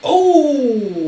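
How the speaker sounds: sudden high, loud gasp of an oh; real shock at the punchline. Real, not acted. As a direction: caught completely off guard. Reacting to chlamydia punchline.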